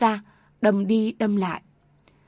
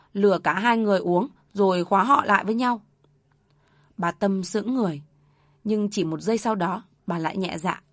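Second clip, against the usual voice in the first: plain reading voice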